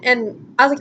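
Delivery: ordinary talk, nothing marked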